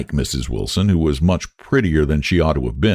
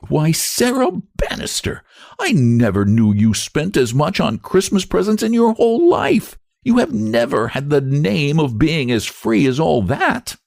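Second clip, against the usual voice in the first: Lottie voice; 9 semitones higher than usual. A narrator's reading. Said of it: "sped her own little shaft".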